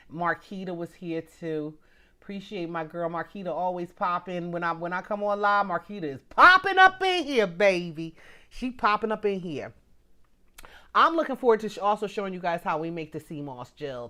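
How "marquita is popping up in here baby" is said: big, raised-voice cheer for a friend; excited hype. Real, not acted. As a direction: hype her up at full voice. Hyping a regular viewer.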